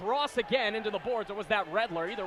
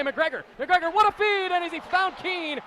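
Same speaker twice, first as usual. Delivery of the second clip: excited play-by-play; 9 semitones higher, 5 dB louder than usual. Real, not acted. Scoring chance rush.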